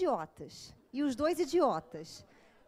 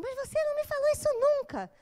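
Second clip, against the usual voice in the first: much higher, put-on protesting voice; indignant client; 11 semitones higher, 4 dB louder than usual. Client claiming she was never told, much higher and indignant. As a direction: whine it like the wronged client. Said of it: "ela fala assim".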